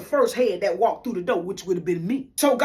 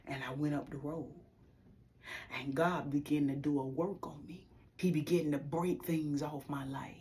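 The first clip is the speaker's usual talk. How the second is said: much softer, half-whispered telling; hushed testimony; 4 semitones lower, 10 dB quieter than usual. Real, not acted. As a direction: let it drop to a near whisper, moved. Tender memory after crying.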